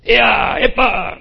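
loud, high shouts to the horses; sharp high yells of command; driver's command shout. Shown as a demonstration. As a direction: shout orders as if driving a carriage. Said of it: "en forma de grito".

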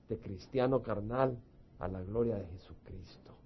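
hushed, tender invitation to pray; gentle altar call. Acted. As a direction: coax them gently toward forgiveness. Soft altar-call appeal to congregation.

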